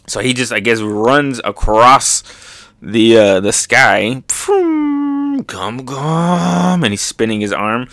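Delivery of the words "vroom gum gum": high whoosh into stretched attack call; mock battle wind-up; wind-up attack noise. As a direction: zoom up high, then draw out the chant. Imitates flight and attack call.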